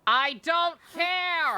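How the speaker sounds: slow, high, loud yell; drawn-out exasperated shout; shouted brush-off. Real, not acted. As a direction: bellow it out, fed up. Streamer dismisses game line.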